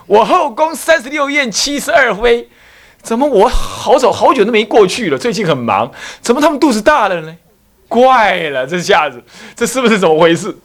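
the king scratching his head over his concubines; puzzled emperor. Acted. Emperor puzzled about pregnant consorts.

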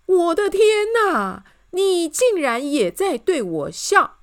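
high, loud, drawn-out shriek; horrified scream. A narrator's reading. King sees her smiling at him too, screaming. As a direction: scream it slowly in horror. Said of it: "国王尖叫说".

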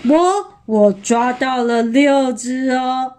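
slow, self-satisfied boast; drawn-out, proud storybook voice; smug. Acted. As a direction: savour the bragging, word by word. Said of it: "马上得意洋洋地说".

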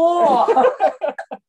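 high, loud burst of laughter. Real, not acted. Cracks up at co-host's joke, loud high laugh.

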